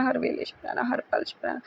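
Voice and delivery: whispered thanks. Real, not acted.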